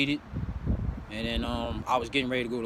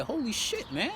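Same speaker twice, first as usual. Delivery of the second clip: awed; 9 semitones higher, 4 dB quieter than usual. Retold or imitated. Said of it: "I'm like".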